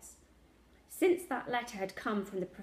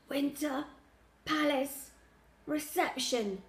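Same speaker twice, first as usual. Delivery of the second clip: higher, broken into gasped single words; wheezing; 6 semitones higher than usual. A narrator's reading. Sick grandad answering Lily's question, wheezing. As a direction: wheeze out each word separately. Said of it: "he wheezed".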